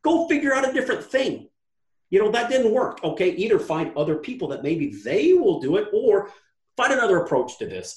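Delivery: high, half-laughing retold tirade; heated rant. Host retelling his rant at the entitled student, heated and laughing. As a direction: rant at her, amused at your own heat.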